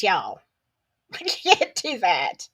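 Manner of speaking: ordinary talk, nothing marked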